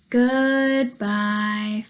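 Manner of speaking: ordinary talk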